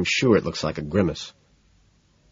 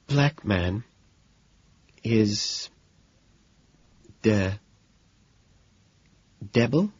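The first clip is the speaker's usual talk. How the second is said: soft, halting, ending in a question; faltering; 5 dB quieter, much slower than usual. Acted. Woman reading the last line of his poem, faltering. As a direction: falter word by word into bewilderment. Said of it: "She falters again, then reads the last sentence, sighing".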